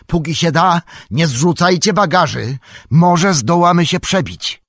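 high, loud urgent order; shouted command. A narrator's reading. Aragorn ordering companions to bar the doors, shouted command. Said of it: "krzyknął Aragorn".

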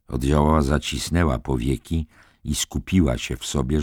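usual speaking voice

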